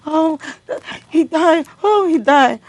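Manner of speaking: loud, high wailing cry of grief; retold crying scream. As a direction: wail it out as the mother did. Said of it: "My mother started crying and screaming".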